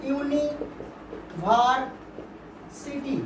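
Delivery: "University" very slowly as ইউনি, ভার, city; long pauses inside "university", in three parts; split into syllable groups. Shown as a demonstration. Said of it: "তিনটে করে syllable হচ্ছে".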